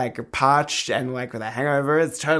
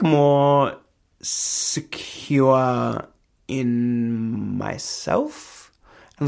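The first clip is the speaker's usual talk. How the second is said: usual speaking voice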